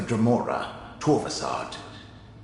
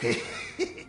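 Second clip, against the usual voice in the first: quiet unvoiced chuckle; a soft, smug little laugh; 2 semitones higher, 5 dB quieter than usual. Ghost mage gloating over rival.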